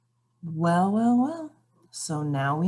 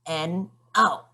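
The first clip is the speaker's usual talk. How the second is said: slow, stubborn spelling of no; grumpy gnome spelling it out slowly; spelled-out no. Acted. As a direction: drag out each letter defiantly.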